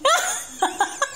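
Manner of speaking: sudden high giggle; high burst of laughter. Real, not acted. Mother laughs at child's answer. (taas nga katawa)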